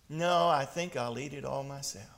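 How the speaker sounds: low, soft, measured punchline; understated storybook chicken; calm refusal. A narrator's reading. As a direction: refuse quietly, with finality. Chicken's deliberate final refusal.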